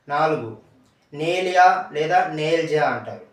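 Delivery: normal talking voice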